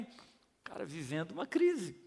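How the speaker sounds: ordinary talk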